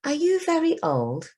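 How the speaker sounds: put-on high, eager child voice; young Ravi asking his grandfather; child's question. A narrator's reading. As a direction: ask it like a curious little boy. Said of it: "said Ravi".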